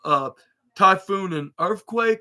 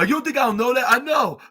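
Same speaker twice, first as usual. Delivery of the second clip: higher, louder acted protest; worked-up retort; 7 semitones higher, 4 dB louder than usual. Jey answering his brother's warning, worked-up retort. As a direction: snap back in a raised voice. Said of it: "kinda corrected himself by saying in a calm voice".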